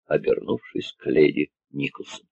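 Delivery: ordinary delivery, nothing marked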